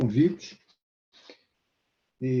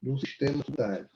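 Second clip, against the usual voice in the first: normal talking voice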